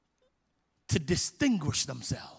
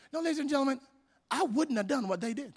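normal talking voice

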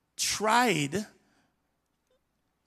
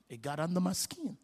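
whispered aside; 8 dB quieter than usual. Real, not acted. Confessing inner irritation.

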